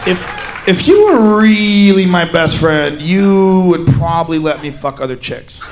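put-on deep boyfriend voice. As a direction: drop way down and confess like the guy.

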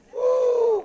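high, loud "woo"; praise whoop. Real, not acted. Preacher overcome with praise, whooping. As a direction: let out one held high whoop. Praise shout mid-sermon.

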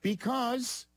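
high-pitched stats pitch; high register. Pretend. Host argues from defensive stats, in a high register. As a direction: keep the voice up high. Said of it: "still gotta go high register".

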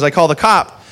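ordinary talk

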